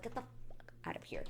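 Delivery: hissed whisper of disbelief; hushed mock-exasperated whisper; whispered outrage. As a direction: swear under your breath. Reacting to contestant's excuse.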